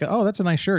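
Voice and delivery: high-pitched mock admiration; put-on admiring tone; imitated compliment giver. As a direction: play someone handing out a compliment, voice lifted high. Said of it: "or like".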